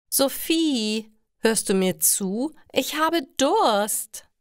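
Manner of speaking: deutlich höher, am Ende langsam und gedehnt quengelnd; quengelnde Ingrid. Acted. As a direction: zieh das Durst fordernd in die Länge. Ingrid kommandiert Sophie erneut. (hoch und quengelig, Ingrid verlangt von Sophie etwas zu trinken)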